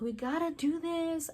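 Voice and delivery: high, slow earnest insistence; dead set, dreamy hero; mock determined Mika.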